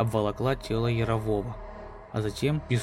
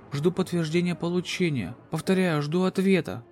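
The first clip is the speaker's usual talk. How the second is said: higher, tense distress report; urgent radio call; 5 semitones higher than usual. Acted. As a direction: call for help over the radio. Captain's distress radio message.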